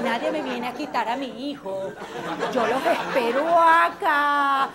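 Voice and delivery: slow, loud challenge; defiant dare. Acted. Mother dares anyone to take her baby, slow and loud. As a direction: dare them to come, slowly.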